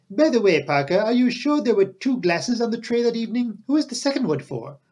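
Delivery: high-pitched inquisitive detective; spring an afterthought on the butler; Poirot. A narrator's reading. Narrator voices Poirot questioning butler.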